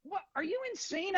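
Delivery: high, incredulous what; mock outrage. [name] shocked at the manager's excuse, high incredulous. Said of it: "And I go".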